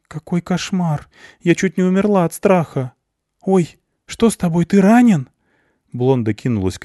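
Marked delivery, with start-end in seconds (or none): 0.1-5.3 high, rising alarm; girl rushing to the wounded guy; lightly voiced scared young woman; frightened girl.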